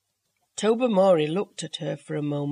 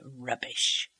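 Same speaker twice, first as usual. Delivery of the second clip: barely voiced, softer rubbish; silently mouthed; 7 semitones lower, 7 dB quieter than usual. A narrator's reading. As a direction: breathe rubbish with the lips, almost no voice. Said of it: "silently formed the word".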